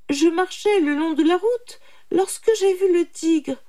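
high, loud character voice; brahman explaining. A narrator's reading. Man retells events to jackal. (brahmane qui explique, voix aiguë)

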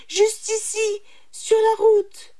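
very high, eager reply; brahman answering. A narrator's reading. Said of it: "répondit le brahman". (brahmane empressé, voix très aiguë)

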